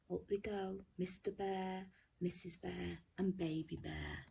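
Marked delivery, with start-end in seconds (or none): none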